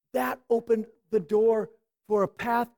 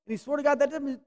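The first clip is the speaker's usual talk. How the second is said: normal talking voice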